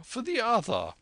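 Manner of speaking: the squire can't wait for the map; eager squire. A narrator's reading.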